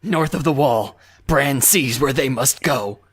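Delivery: mock-menacing action-hero impression; Liam Neeson. Shown as a demonstration. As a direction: read episode notes as a deadly threat. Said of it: "I think you almost had him there".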